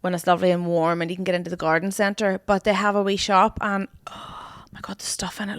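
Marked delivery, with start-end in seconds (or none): 4.1-5.6 whisper. Real, not acted. Gushing about the shop.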